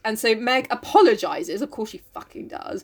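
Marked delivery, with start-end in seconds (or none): 1.6-2.8 soft, muttered sarcastic aside; whispered scorn; under-breath aside. Real, not acted. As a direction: grumble it to yourself.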